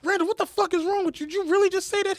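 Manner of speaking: high-pitched retold outrage; outraged scolding. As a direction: scold him in disbelief, pitched right up. Retold manager scolding employee over slur.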